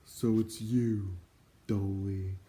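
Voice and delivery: slow, mournful greeting; a hint of a weary doorkeeper; sad voice. A narrator's reading. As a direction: sigh the words out sadly. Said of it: "a sad voice replied".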